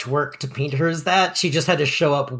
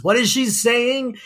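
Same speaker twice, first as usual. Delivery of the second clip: put-on higher-pitched, slow querying old man; imitated old husband; 9 semitones higher, slower than usual. Old husband overhearing Fran talk in the theater, imitated querying voice. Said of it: "her old husband goes".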